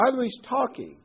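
high, loud mocking taunt. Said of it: "Elijah made fun of them".

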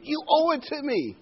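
a hammed-up entitled voice; entitled complainer. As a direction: sneer it like a spoiled ingrate. Preacher voicing entitled complainers.

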